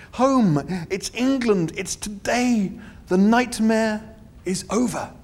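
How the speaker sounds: rising happy disbelief; relieved, excited. Acted. Arthur's relieved outburst.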